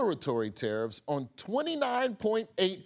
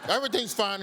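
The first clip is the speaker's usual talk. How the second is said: ordinary talk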